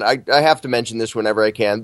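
ordinary talk, nothing marked

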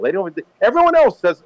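usual speaking voice

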